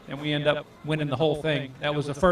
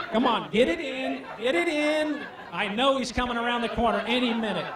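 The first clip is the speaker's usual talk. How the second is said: higher-pitched retold nervous hurry; anxious urging; 5 semitones higher than usual. Angler afraid Whiteman is coming around the corner, anxious urging. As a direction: urge them on, mock-panicked at a higher pitch.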